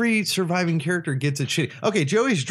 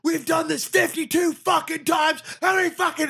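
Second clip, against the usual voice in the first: very high-pitched mock shouting; imitated furious rant. Imitating stuntman furious with director over endless takes, mock furious shout. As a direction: rage at the director in an exaggerated yell. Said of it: "he was fucking furious with the director".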